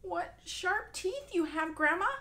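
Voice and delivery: realising the danger; girl growing scared; alarmed girl. Acted.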